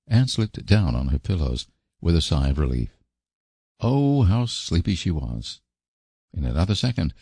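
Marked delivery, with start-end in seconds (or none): none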